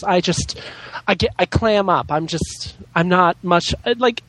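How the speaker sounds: usual speaking voice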